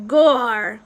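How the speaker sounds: loud, blurted "Gor"; exasperated loud curse; cursing. A narrator's reading. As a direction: curse it out loudly. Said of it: "Meg cursed".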